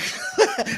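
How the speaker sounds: high, short laugh of disbelief; a burst of amused laughter; incredulous laugh. Real, not acted. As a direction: laugh at how absurd it is. Laughing at the question.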